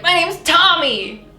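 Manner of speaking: high, loud, drawn-out mock shout. Imagined James announcing a different name, mock shouting. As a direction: call the name out playfully. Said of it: "shouting out a different name".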